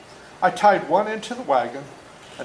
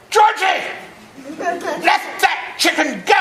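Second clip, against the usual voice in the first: high, slow angry yell of a father; Daddy's shout; 14 semitones higher, 5 dB louder, slower than usual. Retold or imitated. Father catching his son with the hatchet, angry shout. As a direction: bellow it across the yard at the child. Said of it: "I heard a familiar voice".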